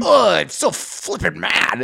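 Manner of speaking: mocking someone too polite to swear; mock prissy fake swearer. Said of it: "the fake swears instead of just".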